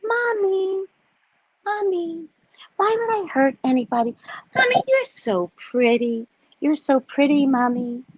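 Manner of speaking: syrupy sweet mock daughter; fake-sweet little girl. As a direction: play innocent, sucking up to mother. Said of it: "All the while thinking".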